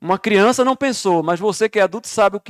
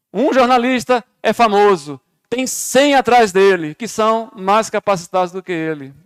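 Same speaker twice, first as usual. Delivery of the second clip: preaching; 4 semitones higher, 5 dB louder, slower than usual. Acted. Preaching at full force.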